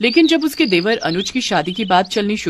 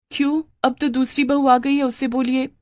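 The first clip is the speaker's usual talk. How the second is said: shirking daughter-in-law; 6 semitones higher than usual. Acted.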